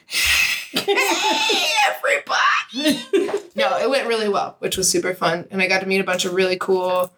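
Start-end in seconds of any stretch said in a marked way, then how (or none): 0.1-3.0 much higher, slow, nervous stage hello; awkward greeting. Retold or imitated. Surprised on stage at a first talk, a nervous squeaky hello. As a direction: squeak it out like a startled speaker. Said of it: "And I was like".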